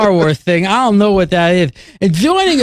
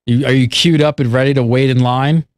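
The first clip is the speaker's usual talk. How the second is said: lisp; 8 semitones lower than usual. Pretend. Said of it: "talk with that lisp".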